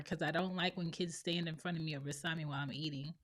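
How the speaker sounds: ordinary talk, nothing marked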